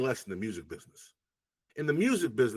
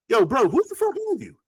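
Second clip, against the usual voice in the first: imitated surprise; 10 semitones higher, 4 dB louder than usual. Writer's puzzled reaction to meeting again.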